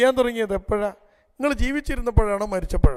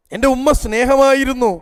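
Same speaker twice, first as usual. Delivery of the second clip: higher, louder, voice full of grief; tearful outburst; 5 semitones higher, 7 dB louder than usual. Retold or imitated. Daughter tells counselor about her late mother, higher and louder with grief. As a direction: cry out how much she loved her mother. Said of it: "അത് പറയലും ഒരു കരയലും".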